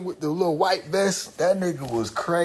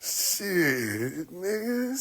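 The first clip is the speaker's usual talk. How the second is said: ordinary delivery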